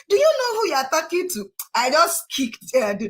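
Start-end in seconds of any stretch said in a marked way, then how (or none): 0.1-1.5 higher, defiant retort; retold high-pitched defiance; indignant challenge. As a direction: challenge him, head high. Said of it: "I was like".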